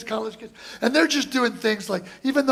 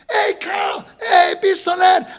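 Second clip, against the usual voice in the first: excited shout; 9 semitones higher, 5 dB louder than usual. Real, not acted. Greeting old friend at show.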